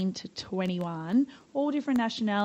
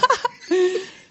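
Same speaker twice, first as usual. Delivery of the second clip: burst of laughter; 11 semitones higher, 7 dB louder than usual. Real, not acted. Laughing at own joke.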